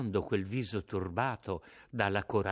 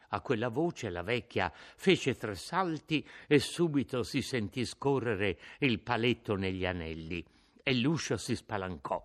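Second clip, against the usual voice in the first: usual speaking voice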